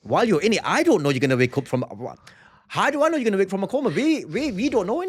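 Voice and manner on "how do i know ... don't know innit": much higher, fast and pleading; high insistent protest. Real, not acted. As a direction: push it up high, can't believe it. Arguing the coma could last.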